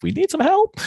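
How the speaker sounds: high, loud mock cry for help; mock plea for help. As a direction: squeal it like a helpless plea.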